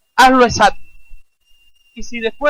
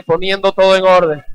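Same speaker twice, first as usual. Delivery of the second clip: louder, emphatic call to repeat; 6 dB louder than usual. Shown as a demonstration. Preacher has the congregation repeat the phrase, loud. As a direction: say it out loud for them to echo. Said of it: "Dígalo fuerte".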